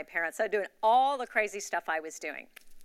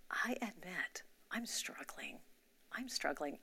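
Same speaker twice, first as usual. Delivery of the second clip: soft whisper of a hard admission; 10 dB quieter, slower than usual. Retold or imitated. Admitting her struggle to God on the bench, whispering. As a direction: let it out in a hush, almost to yourself.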